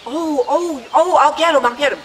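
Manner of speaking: high, fretful hurry; flustered mother. Retold or imitated. Mother offers to fetch father's slippers, flustered. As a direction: imitate a flustered wife rushing to help.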